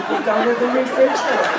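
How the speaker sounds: loud rising punchline. Acted. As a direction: hit the last word hard. Comic punchline delivered big.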